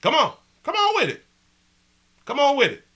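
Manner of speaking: high, insistent come-on; taunting dare. Real, not acted. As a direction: goad them to say it. Daring the chatter to answer.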